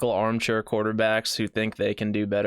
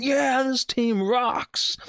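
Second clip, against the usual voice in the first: slow, high fan cheer; lightly voiced cheering fan; mock cheering fan; 13 semitones higher, much slower than usual. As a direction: ham up a happy fan. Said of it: "high-fiving me saying".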